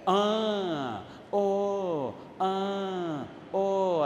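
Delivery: drawn-out "ah" and "oh" of mock amazement; imitated oohs and aahs. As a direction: imitate an awed listener, each sound held long. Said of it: "así dice". (imitando exclamaciones largas de asombro)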